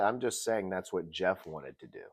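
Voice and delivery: normal talking voice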